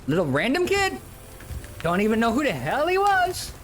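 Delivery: ordinary talk, nothing marked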